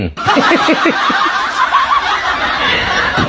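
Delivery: high, breathy burst of laughter. Real, not acted. Laughing at Pak J K claim. (tertawa lepas tak percaya)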